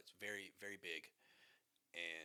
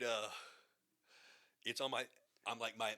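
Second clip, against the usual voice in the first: ordinary delivery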